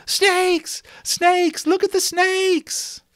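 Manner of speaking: put-on shrill, very high, loud screaming kids; panicked children shrieking at the fire; screaming little boys. Little boys screaming at the older boys, very high and loud. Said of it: "The little boys screamed at them".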